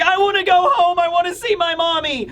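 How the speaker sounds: high mock little-kid wail; whining child. Scared child begging to go home to mommy, high mock whine. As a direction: put on a homesick child's high-pitched plea. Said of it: "children are responding the way that you would imagine".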